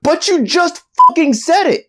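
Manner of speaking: very high, loud comeback; indignant protest. Acted. Narrator calling out co-host's hypocrisy, indignant squeal. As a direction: squeal the accusation back in outrage. Mock argument over cursing.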